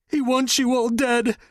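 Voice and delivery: high, strained blurt; terrified confession. Acted. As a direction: force it out through pain. Captive blurts out in pain.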